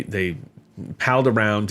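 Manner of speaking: normal talking voice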